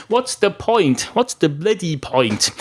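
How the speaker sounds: exasperated dog questioning it all. Acted. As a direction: throw up the question in frustration, drawing out the second one. Dog despairs of being noticed.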